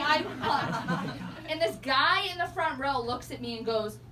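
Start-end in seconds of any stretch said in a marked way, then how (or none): none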